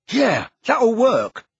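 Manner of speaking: high, loud, drawn-out sarcasm; sarcastic. A narrator's reading. Ron mocking the plan about Tonks, sarcastic. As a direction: stretch it out with heavy sarcasm. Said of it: "said Ron sarcastically".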